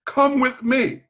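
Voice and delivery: high, loud imitation of the principal calling him along; a lightly voiced principal giving a brisk order; commanding summons. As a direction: mimic the boss beckoning, pitched well up.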